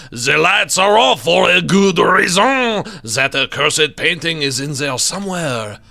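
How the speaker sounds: theatrical French painter, building to loud; French accent. Acted. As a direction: warn dramatically in a thick French accent. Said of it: "It's my best French accent".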